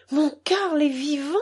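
high, trembling disbelief; a mother daring to hope; astonished. A narrator's reading. Mother learns son is alive.

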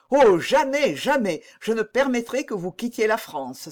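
loud, high royal protest; crying out. A narrator's reading. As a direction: exclaim in alarm, forbidding it. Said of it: "s'écria le roi".

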